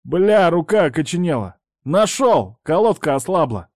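high, loud call through the storm; shouting over wind. Acted. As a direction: yell it out into the blizzard. Outside in blizzard, over wind.

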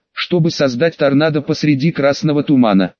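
ordinary delivery, nothing marked

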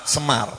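Semar with plain s, dropped low; Javanese pronunciation. Shown as a demonstration. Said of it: "cuman kita nyebutnya semar". (ucapan Jawa Semar)

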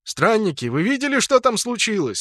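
high, urgent guard's question; inquiring guard. A narrator's reading. Gate guard asks travellers what happened, urgently inquiring.

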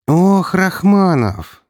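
slow, drawn-out sinister sigh; ominous. Acted. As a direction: stretch the "Ох" with menace. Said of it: "зловеще произнесла она".

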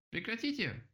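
high-pitched outcry; yelling. A narrator's reading. Rabbit wants the talk to stop, high yell. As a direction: cry out high in protest. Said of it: "завопил кролик".

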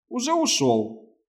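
drawn-out, high and compliant; agreeable. Acted. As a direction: agree meekly, slowly. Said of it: "покладисто согласился лётчик".

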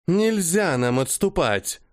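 firm, slow angry refusal; angry retort. Acted. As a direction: bite back against retreat. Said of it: "зло смотрела женщина".

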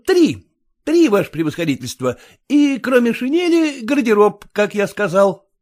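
haggling, mildly insistent; a hint of a wheedling clerk; lightly voiced pleading petitioner. A narrator's reading. Kutsin bargaining with the general, mildly pleading.

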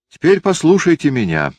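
firm. Acted. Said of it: "твёрдо сказала Лиза".

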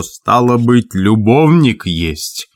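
slow accusing cry, lightly voiced farmer; understated angry shout; shouting in her face. A narrator's reading. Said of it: "и закричал прямо ей в лицо".

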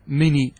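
"مني" with one light n; single noon. Shown as a demonstration. Teacher checking students follow, "مني" with a single short n. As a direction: keep the n of "مني" short, undoubled. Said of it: "وإذا نزعت النون تأتي بالياء فقط".

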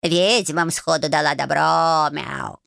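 cat voice. Acted. Cat Bayun speaks.

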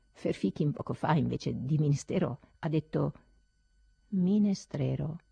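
ordinary delivery

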